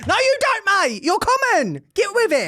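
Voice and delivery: high-pitched indignant British scolding; mock telling-off. Host scolds actors putting on accents, high mock outrage. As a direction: scold them in an exasperated high voice.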